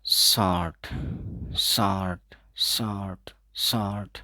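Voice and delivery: शॉर्ट repeated, the r never sounded; silent r drill. Shown as a demonstration. Teacher drilling शॉर्ट for exam students. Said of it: "आर को हम silent रखते हैं".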